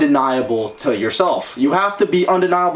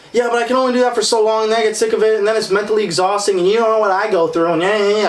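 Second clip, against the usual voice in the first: higher and fast, rattling off complaints; whiny excuse-maker; 6 semitones higher, faster than usual. Retold or imitated. Mocking people who make excuses, fast high whine. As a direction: rush through the excuses like a whiner. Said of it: "people are always like".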